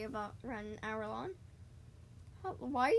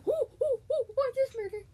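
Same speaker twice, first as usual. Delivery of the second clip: much higher and louder ooh; excited squeals; 12 semitones higher, 8 dB louder than usual. Real, not acted. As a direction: squeal at the discovery. Excited discovery in game.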